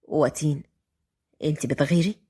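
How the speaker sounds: low, soft murmured tease; whisper. A narrator's reading. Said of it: "وهو بيهمس". (بهمس)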